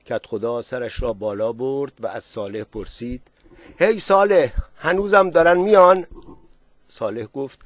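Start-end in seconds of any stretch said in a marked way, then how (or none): 3.8-6.0 raised, high calling voice. A narrator's reading. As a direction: call loudly up to someone on the roof. Calling up to man on roof.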